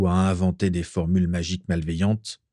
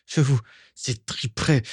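higher-pitched but held-back threat; angry threat; 5 semitones higher than usual. A narrator's reading. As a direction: start the threat through rising anger, without shouting. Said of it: "cria-t-il". (menace contenue, qui monte)

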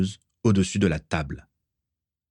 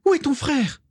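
very high, urgent question; frantic mother's high cry. A narrator's reading. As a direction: ask it urgently, pitched way up. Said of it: "s'écria Ursula en lui sautant au cou".

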